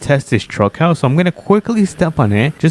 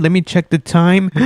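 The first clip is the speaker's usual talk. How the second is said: ordinary delivery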